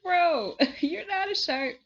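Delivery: high, loud exasperated protest with a laugh; mock annoyed. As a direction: blurt it high, playfully fed up. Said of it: "I'd be so annoyed".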